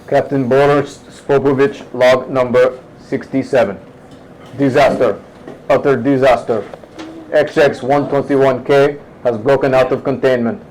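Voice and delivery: put-on grim captain with a light Russian accent. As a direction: read the doomed log in a slight Russian accent. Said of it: "talk in a Russian accent".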